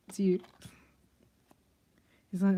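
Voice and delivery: normal talking voice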